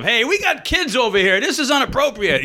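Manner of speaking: high, slow outraged complaint from the crowd; mildly mocked upset parent; indignant heckler. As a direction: play the offended dad calling out. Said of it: "some guy stands up".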